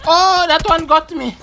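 loud, high, rattled; shaken loud protest. Real, not acted. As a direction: still jumpy from the scare. After being startled.

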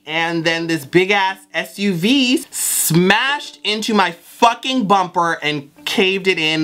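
ordinary talk, nothing marked

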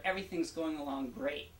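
normal talking voice

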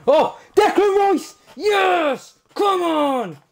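high, loud shout of joy; ecstatic shout. Real, not acted. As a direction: scream with delight at the pack. Packs Declan Rice.

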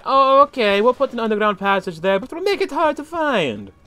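loud, high-pitched mock Italian; mock Italian accent. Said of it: "talking like I'm Italian".

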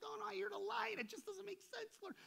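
very high-pitched mock pleading; agitated protest. Preacher acts out arguing with the Lord, agitated. Voices a distressed protest to God.

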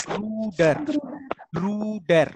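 "Bruder" as two drawn-out syllables, broo-der; first take lifting, second falling on "Bruder"; German pronunciation demo. Shown as a demonstration. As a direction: say Bruder with a long oo. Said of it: "what is the pronunciation".

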